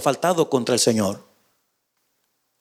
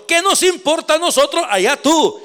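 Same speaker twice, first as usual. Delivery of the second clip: high, scornful brush-off; dismissive priests; 9 semitones higher than usual. Acted. Priests brushing Judas off, scornful and much higher. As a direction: toss it off with contempt, much higher. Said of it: "Ellos dijeron".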